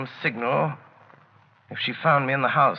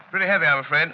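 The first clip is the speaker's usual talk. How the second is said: usual speaking voice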